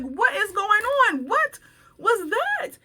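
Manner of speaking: high, alarmed what is going on; startled. Retold or imitated. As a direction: jump up in surprise, pitch high. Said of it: "I walk out of my office like".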